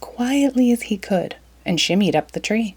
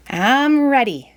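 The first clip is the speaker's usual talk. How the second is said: shouting; 6 semitones higher, slower than usual. Acted. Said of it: "he shouted at last".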